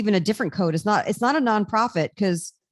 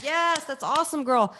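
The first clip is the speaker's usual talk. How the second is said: lightly voiced high cheering church lady; church crowd cheer; 6 semitones higher than usual. Mimicking the congregation cheering her, higher pitched. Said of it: "everyone's like".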